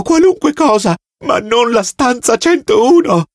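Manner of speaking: terrified prisoner pleading with the officer; desperate begging. Acted. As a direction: beg for your life, voice climbing. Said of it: "gridò".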